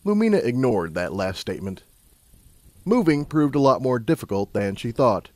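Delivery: plain reading voice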